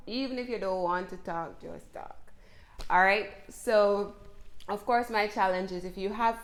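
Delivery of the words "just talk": whispered urging. Acted. Urging learners to talk.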